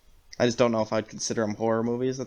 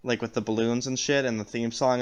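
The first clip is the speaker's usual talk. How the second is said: ordinary talk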